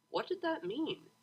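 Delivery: usual speaking voice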